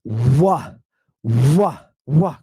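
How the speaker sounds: "Wa" said with a firm rounded w; each "Wa" set apart as a lone syllable; W sound. Shown as a demonstration. Teacher showing the digamma is pronounced like a W, W sound. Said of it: "it's pronounced like a W".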